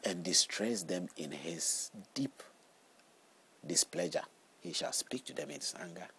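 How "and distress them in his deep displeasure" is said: scripture reading. Acted. Reads Psalm aloud.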